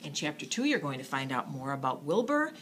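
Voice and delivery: ordinary delivery, nothing marked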